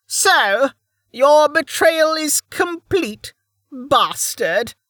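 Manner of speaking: high, slow, venomous crone. Acted. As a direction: spit it at him with contempt. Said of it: "glaring at Emerton venomously".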